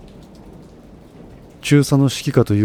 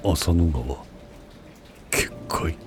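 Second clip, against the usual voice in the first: low, heavy, near-whispered words; low heavy murmur; 4 semitones lower, 8 dB quieter, slower than usual. A narrator's reading. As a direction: let the shock weigh the words down. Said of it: "冷静な軍長の声とは思えないほど低く、重かった".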